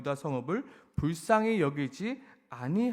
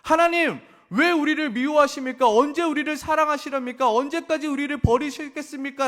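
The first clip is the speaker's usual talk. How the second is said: much higher and louder cry to God; desperate plea; 8 semitones higher, 7 dB louder than usual. Acted. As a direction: wail out the question. Voicing people's plea to God. (높고 크게 절규하듯)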